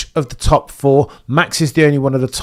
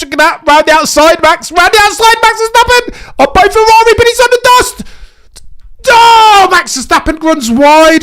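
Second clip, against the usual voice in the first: much higher, screaming commentary; frantic play-by-play; 9 dB louder than usual. Real, not acted. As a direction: lose your mind over Max's launch. Frantic first-lap play-by-play.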